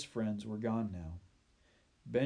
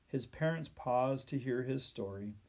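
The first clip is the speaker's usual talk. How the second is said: ordinary delivery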